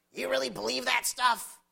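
incredulous sceptic. Acted. A doubter challenges the preacher's belief, loud and incredulous.

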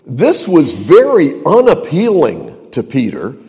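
ordinary talk, nothing marked

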